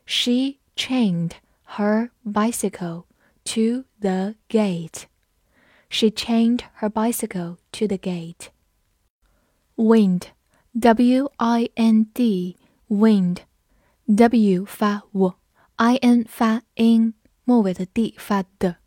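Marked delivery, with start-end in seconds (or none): 0.0-5.0 slow, careful classroom read-aloud; teacher modelling the sentence slowly. Shown as a demonstration. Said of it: "好，我们慢读一遍。". 9.8-14.7 very slow, letter by letter; teacher spelling a word for students. Shown as a demonstration. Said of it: "spelling the word out".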